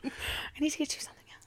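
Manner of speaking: laughing sheepish whisper. Real, not acted. To her cohost, a laughing guilty whisper.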